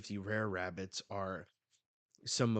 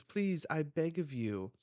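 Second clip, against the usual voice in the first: higher, louder acted begging; mock desperate plea; tearful plea; 8 semitones higher, 5 dB louder than usual. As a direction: plead for mercy. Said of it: "he starts crying".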